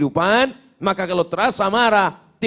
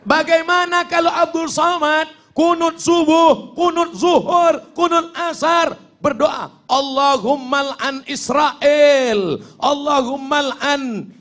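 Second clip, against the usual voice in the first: high, raised preacher's voice, drawn-out endings; impassioned imagined prayer; 7 semitones higher than usual. Acted. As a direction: cry out the curse prayer like a fired-up imam. Imagines fiery qunut curse prayer.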